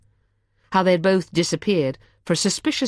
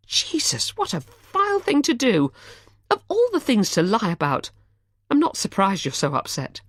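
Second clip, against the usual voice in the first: outraged woman; 7 semitones higher than usual. A narrator's reading. Charlotte outraged.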